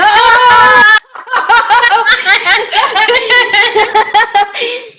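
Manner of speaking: giddy singing laugh; high sung laugh. Real, not acted. Shared sync moment.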